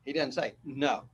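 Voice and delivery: ordinary talk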